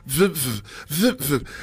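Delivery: short imitated wiper swishes; windshield wiper noise. Radio host acting out wipers clearing a bug, wiper noise. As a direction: imitate wipers with quick "vip" sounds. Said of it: "That's the wiper going across".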